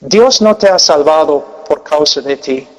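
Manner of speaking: ordinary talk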